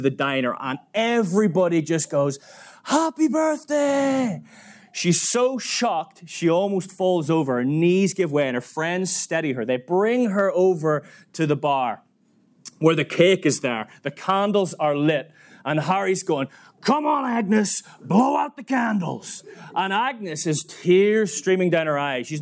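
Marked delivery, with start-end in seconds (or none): 2.8-4.4 high, drawn-out celebratory yell; long, high-pitched party cheer; crowd's birthday shout. Retold or imitated. Whole diner shouts surprise. 16.8-19.4 high, stretched-out coaxing call; raised-pitch cajoling; urging her on. Retold or imitated. Harry urges Agnes on.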